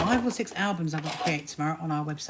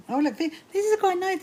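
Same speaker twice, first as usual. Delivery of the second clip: delighted; 11 semitones higher, 3 dB louder than usual. Real, not acted. Spotting a fabric.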